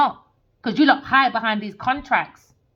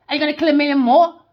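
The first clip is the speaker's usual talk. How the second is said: ordinary talk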